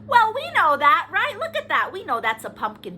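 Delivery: ordinary delivery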